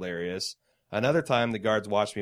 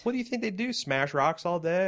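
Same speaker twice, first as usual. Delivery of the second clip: incredulous; 8 semitones higher than usual. Real, not acted. Mocking incredulous question.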